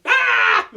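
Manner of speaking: high loud mock scream; silly scream. Imitating the screaming animals, high loud mock scream. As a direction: give a big cartoon scream. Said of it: "all of them just going".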